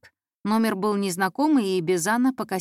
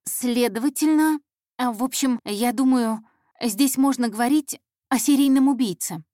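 put-on higher, hesitant girl feeling for words; stall, then venture the theory nervously; increasingly muddled; 4 semitones higher, 4 dB louder than usual. Intern pitching a theory to her boss, flustered and muddled. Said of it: "всё больше путаясь".

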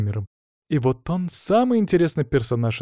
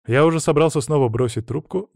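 ordinary delivery, nothing marked

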